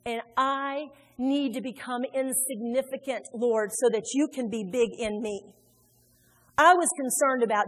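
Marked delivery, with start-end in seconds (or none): 0.1-5.6 slow, softened prayer of surrender; humble prayer. Acted. Preacher voicing the prayer she never said, humble and slow. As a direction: confess it slowly and quietly to God.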